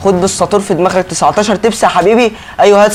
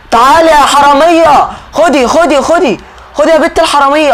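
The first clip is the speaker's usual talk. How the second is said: furious yelling; 7 semitones higher than usual. Acted. Driver yelling at a passenger who ran off, furious. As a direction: scream after her to come back.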